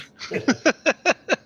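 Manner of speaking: a burst of high laughter at his own bad luck; rueful laugh at a failed roll; real laugh. Real, not acted. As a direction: laugh out loud, high and sudden.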